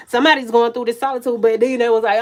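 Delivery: ordinary talk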